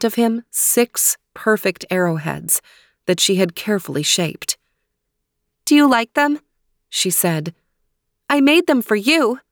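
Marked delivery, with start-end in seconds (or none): 5.6-9.4 put-on young princess voice.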